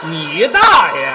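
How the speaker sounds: drawn-out retort. Acted. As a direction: stretch the insult out slowly. Hurls the insult back, drawn out.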